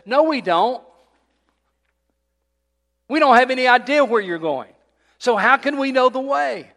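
high, loud exasperated protest; exasperated Thomas. Retold or imitated. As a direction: Thomas throwing up his hands, baffled and loud. Thomas voiced in sermon retelling.

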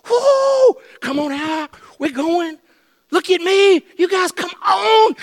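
high-pitched whoops and calls; acted whooping excitement. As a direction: holler to friends, voice way up high. Acting disciples whooping on the water.

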